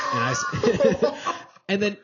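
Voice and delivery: breaking into laughter; laughing. Real, not acted. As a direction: laugh through the words. Laughing at own story.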